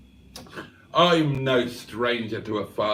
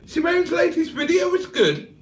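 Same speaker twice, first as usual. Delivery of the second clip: much higher falsetto reading; high put-on voice. A viewer's praise read in a much higher falsetto voice. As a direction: pitch it way up in a funny voice. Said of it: "Cheeseburger says".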